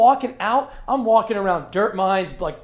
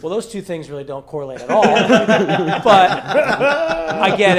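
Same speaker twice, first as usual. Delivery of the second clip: laughing retort; 9 dB louder than usual. Retold or imitated. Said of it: "And I'm like".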